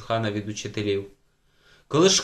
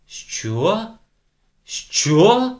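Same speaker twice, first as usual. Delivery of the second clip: loud question, rising higher and louder; shocked disbelief; 9 semitones higher, 8 dB louder than usual. A narrator's reading. Teacher hearing it was someone from school, shocked question. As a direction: repeat it, escalating to a high cry.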